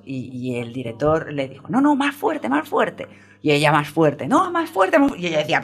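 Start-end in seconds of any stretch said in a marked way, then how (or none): 1.7-3.1 mock demanding director. Director demanding louder screams. 4.3-5.1 mock demanding director. Director demanding louder screams.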